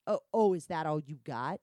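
loud, goading taunt; challenging. Retold or imitated. Director goading the performer to continue, challenging. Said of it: "he starts challenging me".

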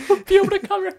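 high, loud laughing repeat. Real, not acted. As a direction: crack up while repeating it. Cracking up at the article.

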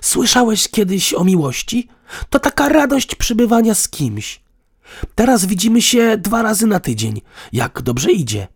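high, loud mock lesson; sarcastic. A narrator's reading. Woman explains love to partner, sarcastically. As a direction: ladle on the sarcasm, pitched high. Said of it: "W jej głosie pobrzmiewał sarkazm".

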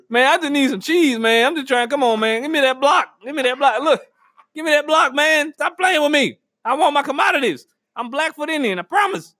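mock pleading. Man begging at the commodity line, mock-indignant pleading. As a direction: plead your case like you are being cheated.

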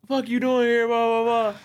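high-pitched mock confrontation; loud voice. Boyfriend storming up to the table, loud angry voice. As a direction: imitate an angry man demanding answers. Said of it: "I hear a loud voice".

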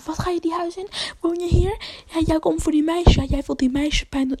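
high, pushy challenge, imitating an accuser; mock confrontation. Retold woman confronts the uncle.

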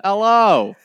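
loud, high Yorkshire hello; mock Yorkshire accent. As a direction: belt out an exaggerated Yorkshire greeting. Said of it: "You've got me already with it".

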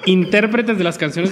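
slow, laughing through the facts; laughing while talking. Real, not acted. Still laughing after the joke, explaining [name]'s career. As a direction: let the laugh ride the words.

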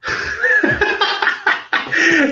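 high breathy mocking cackle; mocking laugh. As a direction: laugh at her scornfully. Mocks her moral claim.

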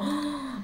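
sharp intake of breath; imitated shocked gasp. Imitating coworkers all staring at her, mock gasp. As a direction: gasp as a whole staring office. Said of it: "están todos viéndola como".

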